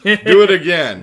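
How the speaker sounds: loud, demanding robot command; booming robot order. Retold or imitated. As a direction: bark it like a suspicious guard robot.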